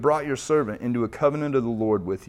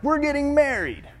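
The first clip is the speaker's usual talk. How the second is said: much higher, gushing put-on voice; mock friends voice; 14 semitones higher than usual. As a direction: play the giddy engaged couple. Friends announcing their wedding.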